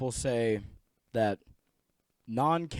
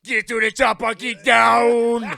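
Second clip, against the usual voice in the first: Arnold impression; 6 semitones higher, 8 dB louder than usual. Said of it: "it is the Terminator".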